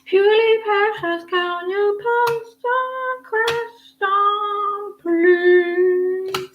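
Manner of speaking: high, very slow sing-song request; sing-song call. Real, not acted. Asking viewer Purely Precious to post, slow high sing-song. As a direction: stretch each word out high like calling across a room.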